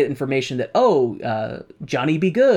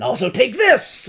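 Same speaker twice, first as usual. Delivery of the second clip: triumphant yell; 9 semitones higher, 4 dB louder than usual. Retold or imitated.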